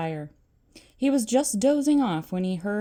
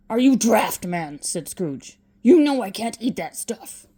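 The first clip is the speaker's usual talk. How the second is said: put-on loud cranky old man; grumpy retort; 6 dB louder than usual. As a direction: spit out the refusal. Scrooge refusing dinner.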